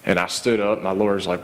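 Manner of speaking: normal talking voice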